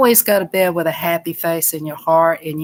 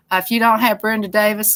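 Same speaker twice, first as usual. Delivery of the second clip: usual speaking voice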